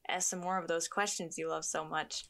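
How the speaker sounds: normal talking voice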